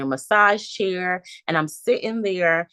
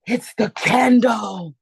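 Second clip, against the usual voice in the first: amazed realization; 3 semitones higher, 5 dB louder, much slower than usual. Retold or imitated. Discovering the candle scent.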